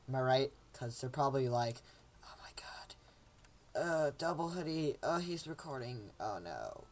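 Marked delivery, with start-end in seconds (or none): 2.2-6.9 very soft, fading imitation; mock dismay under the breath; hushed neighbours. Neighbours overhearing a recording, hushed mock dismay. Imagines neighbours muttering about him.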